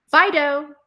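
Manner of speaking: Fido called out loud and high; calling the dog. Shown as a demonstration. Trainer calls Fido before a command, loud and high. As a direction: call the dog's name across the yard. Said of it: "always say the dog's name".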